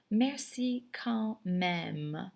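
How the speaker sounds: Merci quand même very slow, rising; very slow second take. Shown as a demonstration. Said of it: "you would say".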